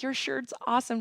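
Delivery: high, gushing reenacted compliment; reliving a thrilled compliment; excited retelling. Acting out excited compliment to stranger.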